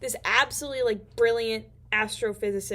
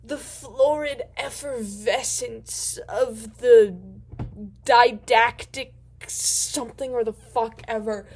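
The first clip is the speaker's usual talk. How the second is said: slow, grand mock eloquence, a bit louder; lightly voiced Arthur hamming up big words; pompous fancy talk; 5 dB louder, slower than usual. Arthur showing off his vocabulary to the Vogon, in slow mock grandeur. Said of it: "pulls some incredible language out of his ass".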